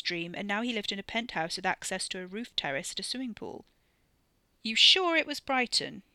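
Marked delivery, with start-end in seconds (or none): 4.6-6.0 higher, louder disbelief; incredulous question. Acted. Man querying his companion's memory of their plan, incredulous. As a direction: challenge it in disbelief. Doubts the remembered town.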